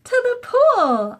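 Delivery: very high, loud, drawn-out yell; the boy yelling the way to the pool. A narrator's reading. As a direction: cry it out with all your might. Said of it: "I yelled".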